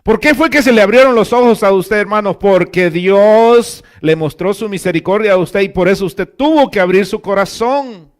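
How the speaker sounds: high, loud preaching with a driving push; full-force preaching. Acted. Pastor preaching to the congregation, loud and high.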